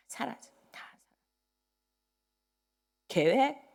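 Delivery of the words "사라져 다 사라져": soft unvoiced whisper, trailing away; fading whisper. Pastor on the father's lost fortune, whispering. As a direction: let it vanish into a breath.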